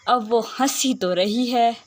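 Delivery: normal talking voice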